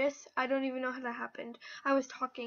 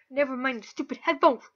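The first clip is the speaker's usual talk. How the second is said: blurted aside; 12 dB louder, faster than usual. Real, not acted. Sudden blurted change of mind.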